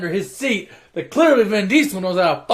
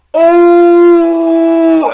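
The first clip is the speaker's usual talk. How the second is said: long, high, loud mock jeer; loud crowd "ooh"; 11 semitones higher, 13 dB louder than usual. Onlookers hear the put-down, loud drawn-out jeering ooh.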